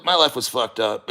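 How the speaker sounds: ordinary delivery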